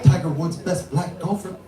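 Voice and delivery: imitated Japanese father, evenly delivered; dad's Japanese accent. Comedian quoting dad on golf, mock Japanese accent. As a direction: put on dad's Japanese accent, no big punch. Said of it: "my dad was like".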